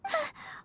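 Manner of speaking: quick, soft, sharp intake of breath; startled gasp. Acted. Paimon startled by a noise nearby, a soft gasp. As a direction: gasp at a sudden sound.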